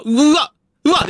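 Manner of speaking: high, loud yelp of fright; real startled shriek in a horror game; startled scream. Real, not acted. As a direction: jump out of your skin and yelp.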